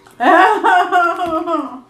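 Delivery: high, loud burst of laughter. Real, not acted. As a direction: let out a big laugh.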